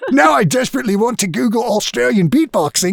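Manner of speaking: put-on high, laughing Aussie accent; Australian accent. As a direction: keep up the joke Australian accent. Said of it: "I don't know why I got Australian".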